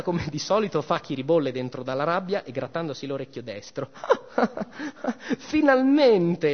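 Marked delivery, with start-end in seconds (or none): 3.9-5.4 a high scornful cackle; mocking laugh. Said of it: "in una sonora sghignazzata". 5.5-6.5 slow, high scornful shout. Acted. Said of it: "le gridò".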